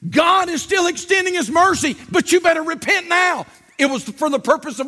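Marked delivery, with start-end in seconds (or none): 0.1-3.4 high, insistent preaching plea; urgent warning. Shown as a demonstration. Preacher warning sinners of judgment, urgent raised voice. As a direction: warn them with pitch raised.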